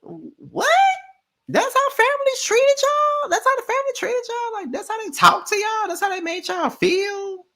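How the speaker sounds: squeaky, very high incredulous voice, far above her own; high-pitched mocking shock; mock disbelief. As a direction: play it as an outraged, squealing cast member. Mocking cast members' shocked disbelief.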